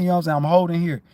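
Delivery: ordinary delivery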